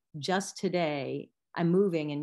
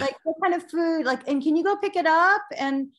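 mock yelling out; 11 semitones higher, 7 dB louder than usual. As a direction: holler like a kid calling mom from another room. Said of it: "they probably would yell out to me".

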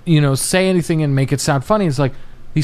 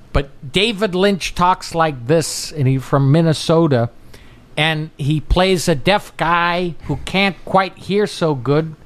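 slow, drawn-out Lynch-style talk; lazy Midwestern drawl; David Lynch voice; slower than usual. Retold or imitated. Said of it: "I'm gonna stop doing the impression".

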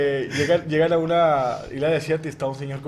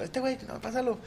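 hushed; 7 semitones higher, 9 dB quieter than usual. Acted. Sending the next jumper, hushed.

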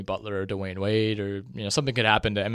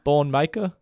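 higher-pitched Australian accent, tentatively tried out; attempted Australian accent; 5 semitones higher, slower than usual. Shown as a demonstration. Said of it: "saying it in an Australian accent".

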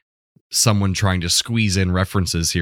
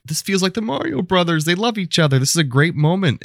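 lifted, excited gush at the sweet moment; light up as if watching it again; gushing delight; 11 semitones higher than usual. Retold or imitated. Fan gushing to co-host about brothers' scene, excited.

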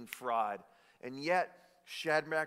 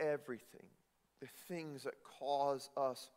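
normal talking voice

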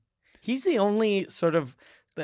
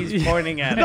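ordinary talk

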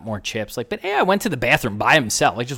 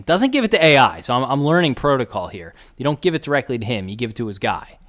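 ordinary delivery

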